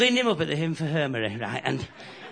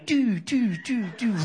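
higher, tooting little doo sounds; imitated whistling leak; 6 semitones higher, slower than usual. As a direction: toot it like water whistling through holes. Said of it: "and it's going".

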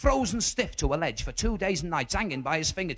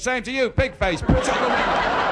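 indignant retort; 7 semitones higher, 6 dB louder, slower than usual. Retold or imitated. Insulted reply.

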